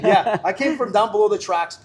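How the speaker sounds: says it again, laughing; laughing repeat. Real, not acted. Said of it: "You say".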